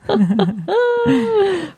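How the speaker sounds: bright burst of laughter; laughing. Real, not acted. Laughs at the cat story.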